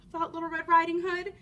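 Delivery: ordinary delivery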